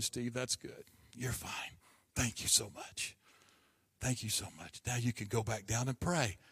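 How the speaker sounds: soft, hushed and reassuring; calm the crying boy gently; the dean softly reassuring the student; comforting whisper. Retold or imitated.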